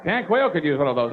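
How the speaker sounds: ordinary talk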